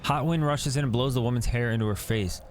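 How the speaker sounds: ordinary talk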